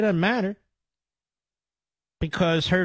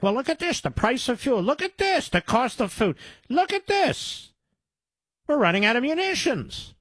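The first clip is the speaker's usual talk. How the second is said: mock alarm; 9 semitones higher than usual. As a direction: act shocked in a much higher voice. Mocking the opponents' feigned alarm at consequences.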